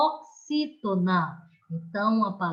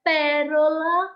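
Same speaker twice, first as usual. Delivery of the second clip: Pérola with PÉ hit higher, louder and slow; teacher drawing out Pérola for the class; stress on PÉ; 5 semitones higher, 5 dB louder than usual. Shown as a demonstration. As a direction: lean hard on the first syllable of Pérola. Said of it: "Vamos observar essas palavras".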